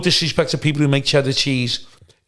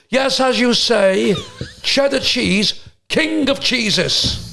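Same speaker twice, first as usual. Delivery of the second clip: posh comic host impression; 8 semitones higher, slower than usual. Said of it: "And he goes".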